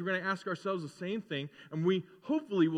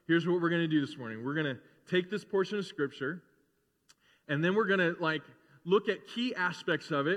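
ordinary talk

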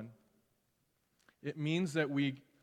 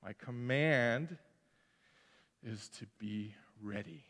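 Jesus; 5 semitones lower, 8 dB quieter than usual. Retold or imitated. Jesus telling disciples to be ready, low solemn command. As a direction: lower the voice and speak with calm authority. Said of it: "And Jesus says".

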